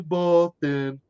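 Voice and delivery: slow mock Boston accent, Boston as Poston. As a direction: ham up a Boston accent, slowly. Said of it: "They can't say Boston".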